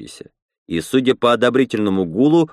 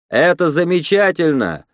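higher-pitched, restrained call from a crowd; lightly voiced exclamation, pitched up; mild crowd shout; 7 semitones higher than usual. A narrator's reading. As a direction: call it out without full volume. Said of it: "выкрикнул кто-то из толпы".

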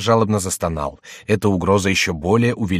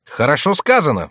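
higher, louder cry of approval; jester's exclamation; 7 semitones higher, 5 dB louder than usual. A narrator's reading. Jester applauds the voice's rebuke, crying out. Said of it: "вскричал Шико".

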